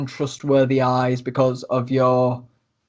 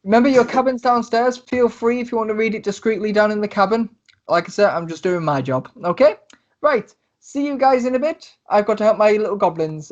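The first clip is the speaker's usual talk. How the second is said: high, friendly but hurried instructions; much higher, cheerful dockmaster voice; busy Tesla; 6 semitones higher than usual. Acted. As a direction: wrap it up quickly, you've work to do. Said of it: "a letter and goes".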